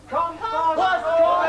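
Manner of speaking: slow, stretched, high shout of the title; drawn-out high bellow; shouted catchphrase. Acted. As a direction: yell it like a jingle. Shouted catchphrase on kid show.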